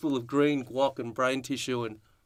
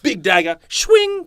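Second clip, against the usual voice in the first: loud, much higher on swing; dramatic shout; 9 semitones higher, 9 dB louder, slower than usual. Acted. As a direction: yell it like the blade falls. Acting out the hand chop.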